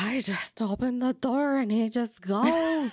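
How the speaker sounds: put-on slurred drunk whisper, slow and high; drunk person voice. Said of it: "your drunk person voice".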